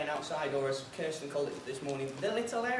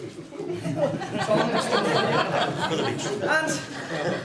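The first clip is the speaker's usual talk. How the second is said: bursts out laughing, high and loud; a big real laugh before the punchline; hearty laugh; 10 semitones higher, 5 dB louder than usual. Real, not acted. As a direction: laugh at your own joke. Speaker cracks up at punchline.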